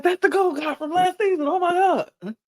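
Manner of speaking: very high-pitched imitation of amazement; mock amazed squeal. [name] recognizes Harrison from last season, mock amazed squeal. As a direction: squeak it out, hammed-up star-struck.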